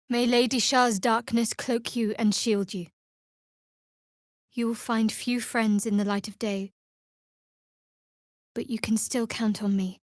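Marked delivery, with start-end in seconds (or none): none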